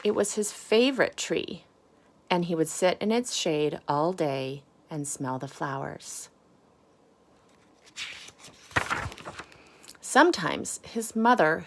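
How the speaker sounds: lively picture-book storytelling; reading to children. Acted. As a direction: read it to the kids, expressive and clear.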